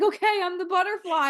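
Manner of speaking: high, loud mock declaration; excited acceptance. Friend retells embracing the butterfly card, excited declaration. Acting out eager acceptance of role.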